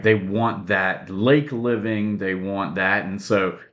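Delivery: normal talking voice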